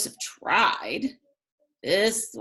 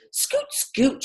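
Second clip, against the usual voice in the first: very high, flustered shoo; shooing rabbit; 3 dB louder than usual. A narrator's reading. Rabbit shooing Thomas and Joseph from the garden, very high. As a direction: rabbit chasing pigs out of the garden. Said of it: "she said to Thomas and Joseph".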